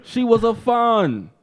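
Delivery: high, slow mock protest; exasperated husband. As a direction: drag out a hammed-up denial. Acted quarrel as defensive Conor.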